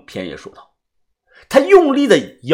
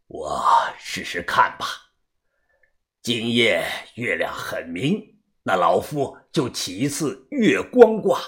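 put-on old fortune-teller; 8 semitones lower, 6 dB quieter than usual. Old diviner weighing a moonlight reading, old fortune-teller. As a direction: ponder aloud as an aged diviner.

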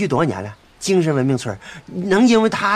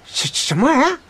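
stammered, higher pitched disbelief; a shocked "what on earth?" squeezed up high; stunned outrage; 8 semitones higher than usual. Acted. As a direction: sputter it out, thrown by the news. Outraged at the news.

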